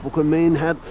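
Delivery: a quick burst of Dublin accent. Shown as a demonstration. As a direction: slip briefly into a Dublin accent. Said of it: "a very good Dublin accent when I want to".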